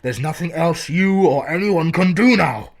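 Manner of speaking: high, loud desperate cry; despairing scream. Acted. Warren begging Carter to flee, despairing scream. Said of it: "changed to a scream of utter despair".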